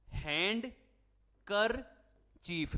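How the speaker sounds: Handkerchief said slowly, every sound clear; slow, spelled-out. Shown as a demonstration. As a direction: drag out handkerchief to show the spelling. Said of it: "ये silent है". (धीरे-धीरे handkerchief)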